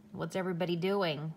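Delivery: ordinary delivery, nothing marked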